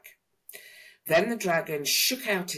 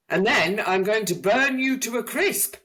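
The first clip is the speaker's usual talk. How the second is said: higher and louder dragon roar; bellowing dragon; 7 semitones higher, 6 dB louder than usual. A narrator's reading. Dragon finishing its threat to Mister Tickle, bellowing. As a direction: boom the punchline threat. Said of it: "bellowed the dragon".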